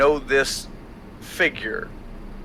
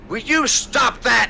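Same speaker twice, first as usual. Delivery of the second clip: raven voice; 8 semitones higher, 3 dB louder than usual. Acted. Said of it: "you see the Raven go".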